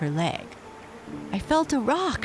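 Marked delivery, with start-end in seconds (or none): 1.3-2.2 high, urgent excited cry. A narrator's reading. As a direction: call it out eagerly. Excited shout in rapids.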